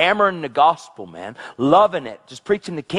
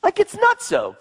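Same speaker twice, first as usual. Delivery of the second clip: high, slow marvelling; high amazed exclamation; slower than usual. Real, not acted. Excited preacher marvelling.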